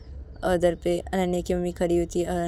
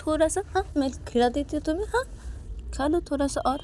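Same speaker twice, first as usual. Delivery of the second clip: higher, sing-song coaxing to eat; coaxing mother; 9 semitones higher than usual. Acted. Mother to crying Payal, sweetly coaxing her to eat. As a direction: coax a sulking child one more bite. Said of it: "तो तभी अनन्या की mummy".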